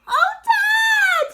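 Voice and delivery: put-on high shouted cry of a young fox; excited cry. A narrator's reading. Smallest fox to his dad, crying out in excitement. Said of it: "he cried out".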